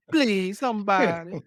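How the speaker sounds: high Southern plea through laughter; hammed-up Southern belle begging; Rogue. Joking as Rogue desperate for a hug, exaggerated Southern accent. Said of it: "a Southern accent too".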